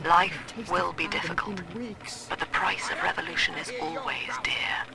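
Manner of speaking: put-on soft, secret whisper to her child; whispered diary. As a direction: confide to your daughter in a hush. Mother's whispered diary to daughter.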